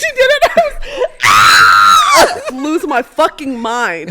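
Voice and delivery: laughing scream. Real, not acted. Excited retelling of clubbing.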